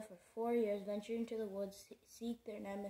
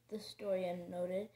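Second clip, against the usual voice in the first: ordinary delivery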